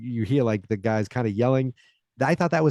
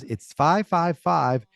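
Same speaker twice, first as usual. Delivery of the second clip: put-on slow helpful kid; 7 semitones higher, much slower than usual. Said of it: "And Heather goes".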